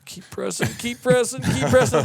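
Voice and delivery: high-pitched, building mock urgency; mock coaching. Instructor coaching a shooter while being stabbed, mock urgency.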